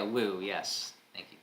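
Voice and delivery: ordinary delivery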